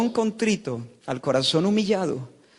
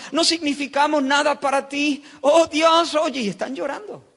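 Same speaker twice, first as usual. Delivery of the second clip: pleading people's lament; 7 semitones higher than usual. Acted. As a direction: plead as the weeping people begging God to answer. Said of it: "Eso es lo que se están preguntando los, los, los judíos". (lamento suplicante del pueblo, agudo y angustiado)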